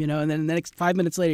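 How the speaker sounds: normal talking voice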